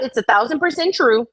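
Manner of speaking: ordinary delivery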